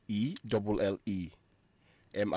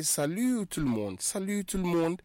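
bright model greeting; 9 semitones higher than usual. Shown as a demonstration. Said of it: "Or you could say".

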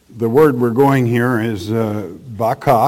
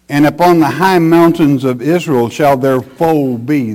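louder, emphatic preacher's Bible reading; forceful scripture reading; 5 dB louder than usual. Acted. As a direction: proclaim the verse from the pulpit. Reads scripture aloud forcefully.

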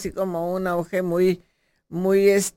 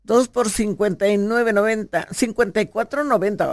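louder, punchy store-offer read; radio ad read; 2 semitones higher, 5 dB louder than usual. Real, not acted. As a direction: sell the supermarket deals loudly. (lectura de anuncio comercial)

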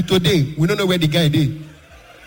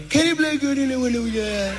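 lightly higher, breezy Trump voice; a hint of Trump, higher pitched; Donald Trump impression; 6 semitones higher than usual. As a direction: sell it like Trump hyping you. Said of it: "Donald Trump".

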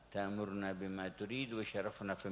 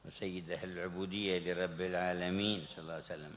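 ordinary delivery

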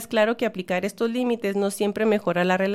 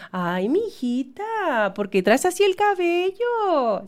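slow, sugary mock concern, pitch rising on "cabello"; fake-concerned aunt; 7 semitones higher, slower than usual. Aunt pretending to worry about niece's hair, slow mock concern. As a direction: coo "Ay, mijita" like a meddling aunt faking worry. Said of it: "esa crítica disfrazada de preocupación".

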